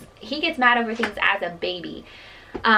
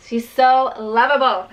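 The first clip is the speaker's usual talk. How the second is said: louder, slow, adoring; drawn out with delight; gushing; 2 semitones higher, 6 dB louder than usual. Real, not acted. Mom gushes to viewers about her kissing toddler, slow and loud.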